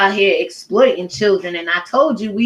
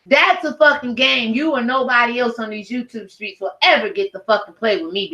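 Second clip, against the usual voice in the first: ordinary talk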